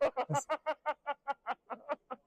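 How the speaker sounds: high-pitched burst of laughter; laughing. Real, not acted. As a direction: crack up laughing. Reacts to hundred-year-old joke.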